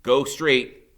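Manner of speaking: ordinary delivery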